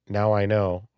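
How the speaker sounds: usual speaking voice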